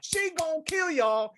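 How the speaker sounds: high, slow mock warning; old lady warning. Retelling the snitching old lady, high drawn-out warning. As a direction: stretch out the threat like an old lady. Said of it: "the old lady was snitching".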